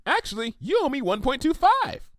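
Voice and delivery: high, bubbly pony voice; Pinkie Pie voice. Acted. Pinkie offering Applejack the money, high and eager. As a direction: chirp it out eager and helpful.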